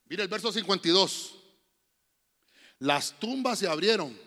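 normal talking voice

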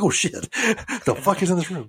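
high, loud jolt of alarm; startled fright. Retold or imitated. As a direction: wake up terrified by a noise. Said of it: "I'm like".

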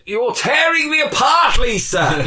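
high shouted strained cry, far above normal; over-the-top anguished shout; Tommy Wiseau impression. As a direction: wail it like a melodramatic movie line. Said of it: "we said".

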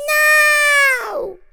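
very high, loud squeal; protesting shout. A narrator's reading. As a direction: refuse outright. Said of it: "protested Jimmy".

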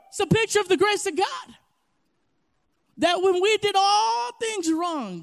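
loud, high-pitched preaching over music; preaching at full force. Acted. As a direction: build to a loud pulpit climax. Preaching climax.

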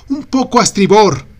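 high, loud urgent yells; shouting. A narrator's reading. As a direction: call each correction sharply.

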